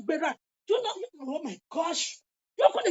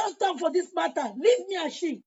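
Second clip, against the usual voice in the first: ordinary delivery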